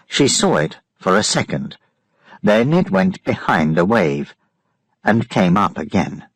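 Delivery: ordinary delivery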